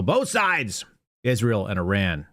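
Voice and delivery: shouting out the all-caps post; reading all caps. Shown as a demonstration. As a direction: shout it the way it's typed. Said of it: "so I read it as such".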